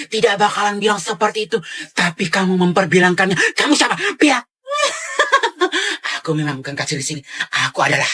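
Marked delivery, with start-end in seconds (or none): 4.7-6.1 high, loud villainous laugh; evil cackle. Acted. Fake cashier revealing herself to the customer, evil cackle.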